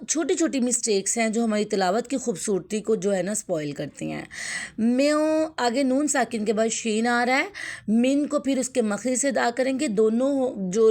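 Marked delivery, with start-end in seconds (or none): 4.8-5.5 میو held long with a hidden nasal n, higher; ikhfa. Shown as a demonstration. Teacher modelling ikhfa, میو with a sustained nasal. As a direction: hold a hummed nasal at the end of میو. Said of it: "یہاں پر اکفا ہو رہا ہے".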